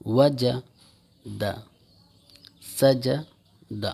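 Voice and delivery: each word stretched very slowly, the third letter in a separate breath; first two letters in one breath, then pause before the last; syllable by syllable, very slow; split syllables. Shown as a demonstration. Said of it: "پہلے دو حرفوں کو ایک سانس میں کہنا ہے اور پھر تیسرے حرف کو الگ سانس میں".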